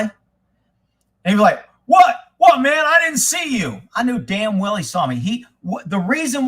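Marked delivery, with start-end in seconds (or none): 1.9-3.8 high, loud "What? What, man?" playing dumb; mock innocent surprise. Sheriff playing innocent to his angry partner, mock surprise. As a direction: feign surprise at being accused.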